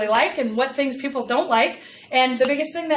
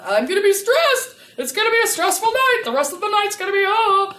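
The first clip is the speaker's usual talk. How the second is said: much higher and louder anxious wail; stressed-out panic; 10 semitones higher, 7 dB louder than usual. Retold or imitated. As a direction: spiral into overwhelm. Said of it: "jump into this idea of".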